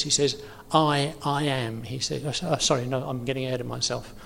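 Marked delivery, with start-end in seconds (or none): 0.7-1.8 long, deliberate "I", then "I am"; emphasis on I. Retold or imitated. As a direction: dwell slowly on each "I". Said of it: "putting very heavy emphasis on the word I".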